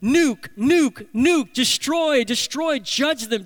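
high, loud, gleeful chant; eager disciples cheering for judgment, giddy. Retold or imitated. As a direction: relish each word like a kid itching for destruction. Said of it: "they're excited about judgment".

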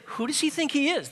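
high, incredulous sneer; mock the hometown boy; scoffing disbelief. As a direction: townsfolk scoffing at a local boy. Crowd scoffing at Jesus.

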